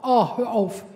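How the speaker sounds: loud mock groan, telling them to stop; disgusted groan. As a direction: groan it out as if someone overshared. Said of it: "dann sagen die direkt".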